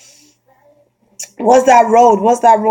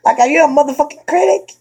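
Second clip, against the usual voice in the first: sassy challenge; 6 semitones higher, faster than usual. Acted. Imagined retort to a critic.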